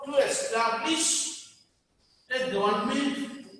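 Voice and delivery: "to establish a government" in slow, separate chunks; slow dictation. Shown as a demonstration. Teacher repeats the party's purpose for students, slow dictation.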